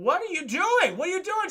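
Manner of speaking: loud, high-pitched incredulous demand; alarmed disbelief. Retold or imitated. Goliath to the woman aiming a bazooka, alarmed. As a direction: cry out in acted alarm.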